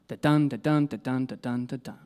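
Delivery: steady da-DUN pulse, five times, fading away; iambic pentameter. Shown as a demonstration. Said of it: "You can almost hear the poetry".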